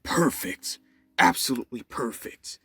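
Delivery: whispered, gleeful villainous gloat. Acted. All For One gloating alone after Deku leaves, whispered villainous glee. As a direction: savour your win in a hushed, wicked breath.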